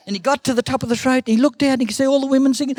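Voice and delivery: quick, high storytelling; excited story narration. A narrator's reading. Uncle tells of the bird reaching the throat, excited. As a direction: rush towards the bird's escape.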